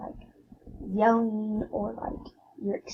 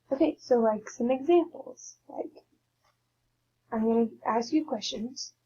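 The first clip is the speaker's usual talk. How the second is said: normal talking voice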